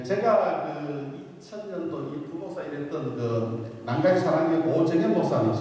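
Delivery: ordinary talk, nothing marked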